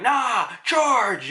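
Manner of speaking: high, loud reckless charge order in a put-on voice; battle cry. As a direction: shout the charge with bravado. Guillotine ordering attack.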